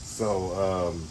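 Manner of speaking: normal talking voice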